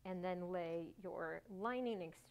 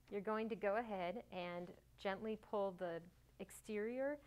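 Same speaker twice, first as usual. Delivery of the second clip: ordinary talk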